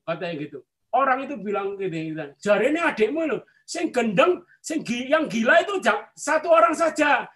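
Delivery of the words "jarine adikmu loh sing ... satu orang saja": higher-pitched retold insult; hurtful jibe. Shopkeeper passing on a sibling's insult, hurtful jibe. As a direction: imitate a taunt meant to wound. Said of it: "berkata gitu untuk menyakiti saya".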